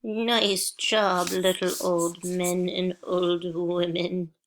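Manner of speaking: lightly voiced old person; a hint of an elderly voice. Shown as a demonstration. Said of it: "In an old person voice".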